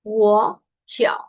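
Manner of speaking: "Wo Xiao" with a clear pause between syllables; pronunciation model. Shown as a demonstration. Teacher showing the correct pronunciation, careful syllable-by-syllable model. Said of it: "la pronunciación correcta".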